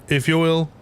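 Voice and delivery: ordinary talk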